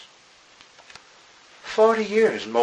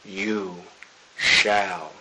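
slow dramatic close; 3 semitones lower, 4 dB quieter, much slower than usual. Acted. Slow emphatic verse close.

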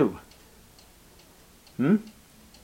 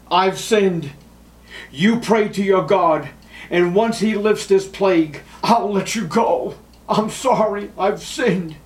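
higher, louder, pleading plea of a cornered king; Pharaoh pleading; 8 semitones higher, 4 dB louder than usual. Acted. Pharaoh begs Moses to pray, higher and louder pleading. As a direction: beg like a ruler who wants the plague gone. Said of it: "what did Pharaoh tell Moses?".

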